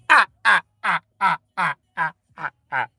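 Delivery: hammed-up hurt yelps; mock pained cries. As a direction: yelp it out and let it die away. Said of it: "He slapped the shit out of Charlie Murphy".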